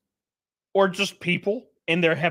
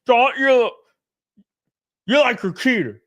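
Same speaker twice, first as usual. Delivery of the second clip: higher, louder mocking voice of a clueless fan; mock dumb commenter; 7 semitones higher, 4 dB louder than usual. Streamer mocking a clueless commenter, higher and louder. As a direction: ham up a dim-witted commenter. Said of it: "They're like".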